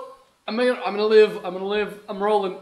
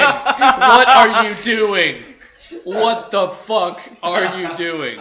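slow, loud laughing question; laughing disbelief; 2 semitones higher, 10 dB louder, much slower than usual. Real, not acted. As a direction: drag it out laughing. Teammate doing dumb stuff.

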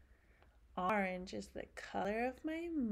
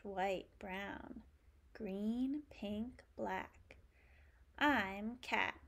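ordinary talk

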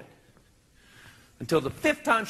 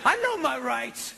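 eager insistence; 9 semitones higher than usual. Retold or imitated. Insisting to cops he hit her.

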